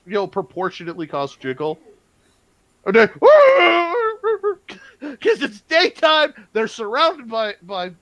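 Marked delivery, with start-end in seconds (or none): 3.2-4.7 high, loud mock scream; panicked goblin scream. Game master as cowardly goblins by daylight, panicked scream. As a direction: shriek like a goblin about to flee.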